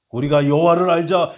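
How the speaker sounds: loud, high call with weight on 여호와를; a preacher crying out Hosea's call to the congregation; preached call. Acted. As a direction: proclaim "여호와를" like a summons.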